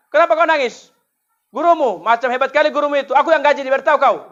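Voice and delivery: high, loud demanding question; a mother snapping at her child; angry mother. Retold or imitated. Mother raging at teacher. (ibu membentak anaknya)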